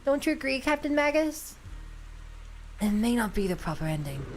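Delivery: low, sincere reading of a heroic character; calm, solemn role-play voice; earnest hero. Acted. Voicing game character dialogue.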